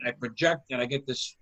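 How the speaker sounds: ordinary talk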